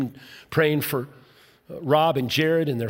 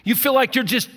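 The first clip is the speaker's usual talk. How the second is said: ordinary talk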